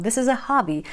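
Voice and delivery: normal talking voice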